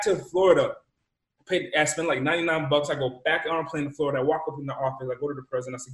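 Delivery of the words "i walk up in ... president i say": ordinary talk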